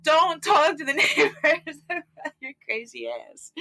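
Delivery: high, slow scolding through laughter; mock scolding breaking into laughter; retold scolding. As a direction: laugh while imitating a partner's telling-off. Retold scolding by her man.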